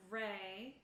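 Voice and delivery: normal talking voice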